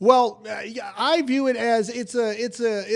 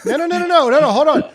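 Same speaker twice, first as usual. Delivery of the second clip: higher and louder, cutting in; loud protest; 6 semitones higher, 7 dB louder, faster than usual. Real, not acted. Objecting to the co-host, higher and louder. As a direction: stop him mid-laugh, loud.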